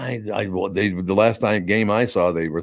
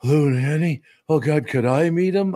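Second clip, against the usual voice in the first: high, eager fan voice; starstruck; 7 semitones higher, slower than usual. Retold or imitated. Friend hearing of lunch with Lou, starstruck. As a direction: gush like an awed fan. Voicing an awed friend's reaction.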